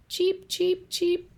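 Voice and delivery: tiny chicks chirping awake; chick cheeps. Acted. Baby chicks hatch.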